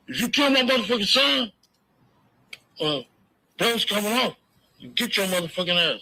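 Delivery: high-pitched imitation of an angry cop; Reggie Senior. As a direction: ham up a cop barking a threat. Retold threat from Reggie Senior.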